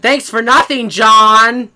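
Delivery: very loud, high-pitched yell; full-volume comic holler; mock angry shout. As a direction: bellow it in mock outrage at a friend. Mock protest against the thanked friend.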